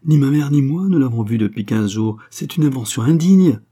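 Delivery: high, loud indignant protest; violent indignation. A narrator's reading. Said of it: "s'écria violemment Angèle". (protestation violente et indignée)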